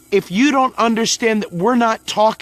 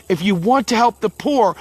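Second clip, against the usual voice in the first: usual speaking voice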